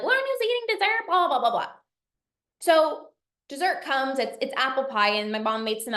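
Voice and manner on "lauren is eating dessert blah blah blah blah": high gossipy mock voice; a lightly voiced nosy guest; mocking gossip. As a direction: mimic the judging guests, pitched up. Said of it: "I didn't want people to be like".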